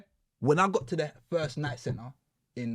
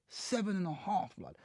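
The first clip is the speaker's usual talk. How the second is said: slow, much softer, numb; stunned and quiet; 5 semitones higher, 7 dB quieter than usual. Retold or imitated. Man staring at the judge after sentencing, stunned and quiet. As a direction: say it flat to his face, still taking it in.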